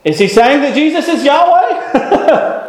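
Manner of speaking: high, incredulous question with a laugh; a touch of disbelief, voicing the congregation; mock astonished listener. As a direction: play the shocked listener, half laughing. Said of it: "you say".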